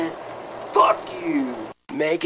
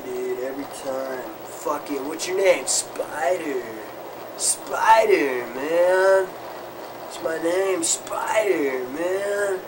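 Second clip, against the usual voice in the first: lower, softer burned-out stoner voice; 4 semitones lower, 5 dB quieter than usual. Acted. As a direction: sink into a lazy, low stoned murmur. Stoner rocker chants and introduces himself.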